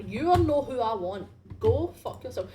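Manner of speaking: loud, annoyed outburst; yelling at the screen. Real, not acted. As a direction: shout at the game. Yelling at game character.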